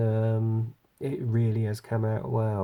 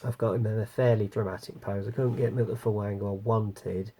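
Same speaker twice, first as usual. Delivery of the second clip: ordinary talk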